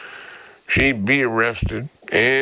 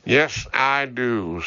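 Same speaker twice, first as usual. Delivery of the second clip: ordinary delivery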